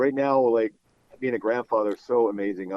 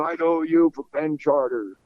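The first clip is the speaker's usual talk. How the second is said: slow, higher-pitched broadcaster imitation; Harry Kalas; 5 semitones higher, slower than usual. Amused broadcaster answers his starstruck guest, drawn-out imitated voice. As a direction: stretch it out as an imitated announcer. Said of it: "And he's just laughing".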